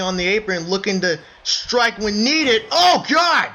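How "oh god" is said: high, loud burst; excited shout. Acted. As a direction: yell as the knee connects. Knee to the face.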